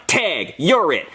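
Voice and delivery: slow, deliberate taunt; unhurried tag-back in own voice; smug payback. Acted. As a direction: draw out each word with menace. His own slow reply after punching.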